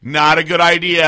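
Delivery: loud, drawn-out warning; loud slow warning. Retold or imitated. Stranger warning her from across the street, loud and slow. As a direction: call out the warning slowly and loudly. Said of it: "She just kept going".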